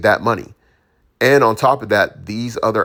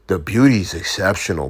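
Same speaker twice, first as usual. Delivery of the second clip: put-on South African accent. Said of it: "With the South African accent".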